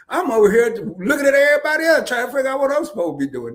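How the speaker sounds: rushing, wide-eyed confusion; flustered excitement. Retold or imitated.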